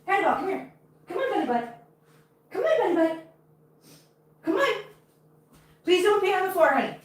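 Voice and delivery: very high, sweet cat-calling voice; coaxing pet talk. Real, not acted. Coaxing her cat over.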